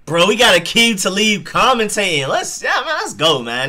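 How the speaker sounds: real excitement at hearing a favorite; excited cheer. Real, not acted. Hyped at favorite commentator.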